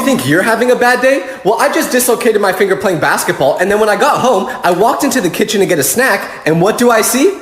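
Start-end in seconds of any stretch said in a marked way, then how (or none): none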